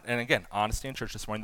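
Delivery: ordinary delivery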